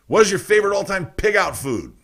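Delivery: normal talking voice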